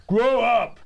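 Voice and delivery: high, loud "Grow up!"; mock scold. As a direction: shout it like a scolding troll. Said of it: "People are tweeting me back".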